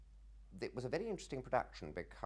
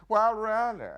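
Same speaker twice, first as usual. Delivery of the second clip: high, loud affronted huff; mock outraged; 14 dB louder, slower than usual. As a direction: play the indignant gentleman. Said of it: "It was all that sort of".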